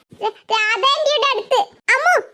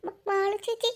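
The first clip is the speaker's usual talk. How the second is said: much softer, hushed voices; hushed sneaking; 9 dB quieter than usual. Acted. Sisters sneaking up to spy, speaking hushed. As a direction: keep it down so they won't hear.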